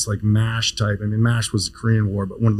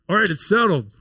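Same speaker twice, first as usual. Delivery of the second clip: lightly voiced chairman, pitched up and final; decisive chairman; 9 semitones higher than usual. Chairman settling the student's research placement, decisive. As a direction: close the deal in one breath. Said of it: "the chairman, goes".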